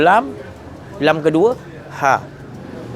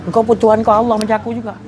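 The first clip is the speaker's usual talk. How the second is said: generous reply; 6 semitones higher than usual. Retold or imitated. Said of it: "lepas tu kita kata".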